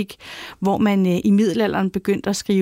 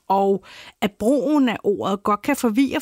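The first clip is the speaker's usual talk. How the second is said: normal talking voice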